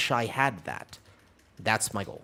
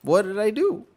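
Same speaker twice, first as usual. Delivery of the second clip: mock innocence; 11 semitones higher, 5 dB louder than usual. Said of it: "And I was like".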